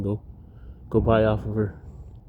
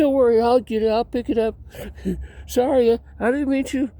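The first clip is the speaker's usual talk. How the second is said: high apologetic voice; 10 semitones higher than usual. Retold or imitated. Apologizing to kids after dropping barrel.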